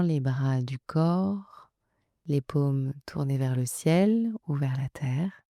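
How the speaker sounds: calm, unhurried relaxation guidance with long pauses; guided meditation. Acted. Coach settling listeners into yoga nidra, calm guided-meditation voice. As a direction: guide them gently into rest, leaving room to breathe.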